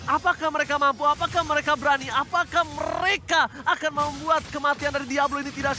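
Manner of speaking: hyped esports play-by-play; loud, stretched caster call; fighting game announcer. Real, not acted.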